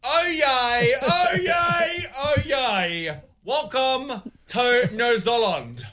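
town crier shout, rising then settling; attempted excited cry. Shown as a demonstration. Coached trainee crier welcoming New Zealand, mildly excited. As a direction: push some excitement into a drawn-out welcome. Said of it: "Sound excited, excited".

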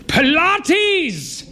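high, loud outraged Pilates; exasperated cry. Acted. Husband mocking wife's new hobby, exasperated cry. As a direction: burst out in disgust. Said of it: "he cried".

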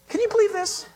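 can you believe it, said in sharp disbelief; incredulous. Real, not acted. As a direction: pitch jumps high in disbelief. Reacting to naive claim.

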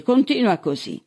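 high-pitched taunting dare; goading. A narrator's reading. Sheriff daring his handcuffed prisoner, goading. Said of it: "lo incitò Siri".

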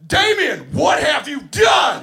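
high, booming yell of horror; top-of-lungs yell. Retold or imitated. Coach loses his mind at the kid in front of everyone, yelling. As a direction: bellow it as if you have completely lost your mind. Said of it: "at the top of my lungs".

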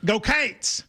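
"Cates" with a long ay vowel, Go Cates; Kentucky "Cates". Shown as a demonstration. Fan teaching the Kentucky cheer "Cates". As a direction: say "Cates" like kayts, one syllable. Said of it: "in Kentucky, we go".

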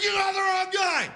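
high, shouted plea of innocence; mock protest. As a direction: yelp it like a wrongly arrested man. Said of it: "I'd be like".

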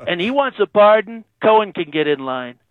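channel a bragging president on the phone; Trump impression. President phoning into radio show, Trump-style boast.

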